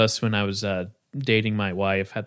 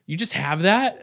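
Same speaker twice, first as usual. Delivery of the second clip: higher-pitched, incredulous quoted question; retold surprise; 7 semitones higher than usual. Friend reacts to the suit jacket, surprised. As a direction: quote her disbelief in a higher voice. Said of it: "she's like".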